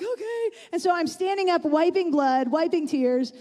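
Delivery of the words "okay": small, high, soft reply; imitated meek little voice; meek compliance. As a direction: answer like a cowed, tearful kid. Cowed child answering yelling dad.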